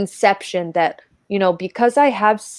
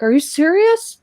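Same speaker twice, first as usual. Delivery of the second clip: fan's surprise; 9 semitones higher than usual. Retold or imitated. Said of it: "And he's like".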